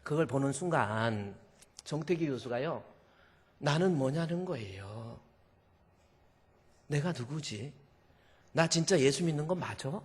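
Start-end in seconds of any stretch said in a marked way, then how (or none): 3.6-10.0 soft, halting inner voice; hushed self-questioning. Retold or imitated. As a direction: ask yourself quietly, stunned. Professor's stunned self-questioning, retold.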